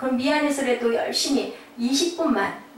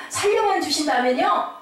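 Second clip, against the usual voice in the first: higher, earnest bargaining; acted pleading; 5 semitones higher, 3 dB louder than usual. Parent bargaining for the child's life, higher earnest plea. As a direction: plead for a deal, pitched up.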